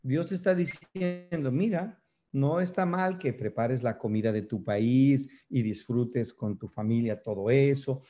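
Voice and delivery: ordinary talk, nothing marked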